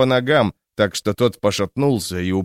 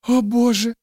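frightened whisper; 12 semitones higher than usual. A narrator's reading. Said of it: "шептала Гессель".

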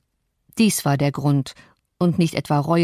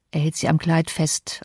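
usual speaking voice

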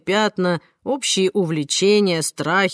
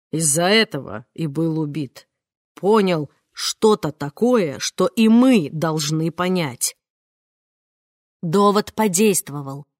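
ordinary delivery, nothing marked

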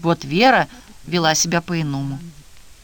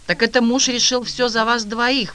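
higher-pitched, mildly indignant exclamation; exclaiming; 7 semitones higher, 3 dB louder than usual. A narrator's reading. Marfa reacts to Vera obeying her husband, exclaiming. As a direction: raise the pitch as if taken aback. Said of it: "воскликнула Марфа".

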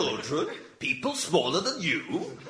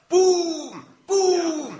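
loud high booming footsteps; giant footsteps; 13 semitones higher, 5 dB louder than usual. Acted. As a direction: stomp it out vocally. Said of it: "watch out".

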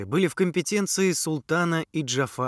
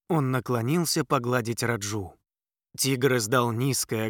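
ordinary delivery

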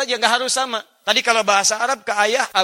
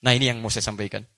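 ordinary delivery

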